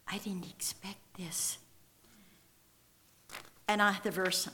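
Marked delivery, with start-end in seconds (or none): 0.1-1.6 soft, much quieter whisper; a moved husband whispering through tears; tearful whisper. Retold or imitated. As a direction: whisper it, overcome.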